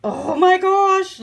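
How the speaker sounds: high, loud and slow awed oh my gosh; excited gasp. Real, not acted. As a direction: stretch it out in delight. Reacts to kit contents.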